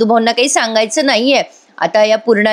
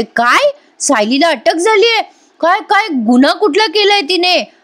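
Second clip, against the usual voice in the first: mock shocked outcry; 7 semitones higher than usual. Priya deliberately crying out about Sayli's arrest, mock shocked outcry. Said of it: "मुद्दाम मी मोठ्याने ओरडते प्रिया".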